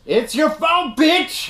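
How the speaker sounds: high, loud joking yell; mock shout. Co-host jokingly yelling blame at the film's daughter. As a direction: bellow the blame as a joke.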